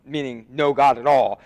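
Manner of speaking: ordinary talk, nothing marked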